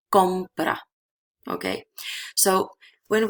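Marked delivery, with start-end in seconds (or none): 0.1-0.8 compra with COM leaned on firmly; stress on first syllable. Shown as a demonstration. Teacher showing where to stress the verb, stress on COM of compra. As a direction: put the beat on COM in compra. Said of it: "we have to stress it here".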